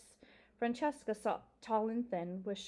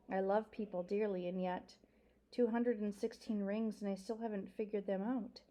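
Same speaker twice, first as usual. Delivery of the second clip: ordinary delivery, nothing marked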